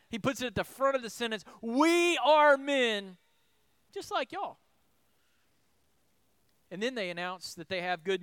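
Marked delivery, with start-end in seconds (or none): 1.6-4.6 "We" punched louder and higher, said slowly; emphatic we. Retold or imitated. Paul insisting to the crowd that they are only men, with "We" stressed. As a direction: lean hard on "We" and take it slowly. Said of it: "which is emphatic".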